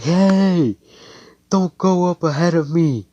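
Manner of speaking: high, loud, drawn-out yell; calling out. Acted. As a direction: holler after someone racing ahead.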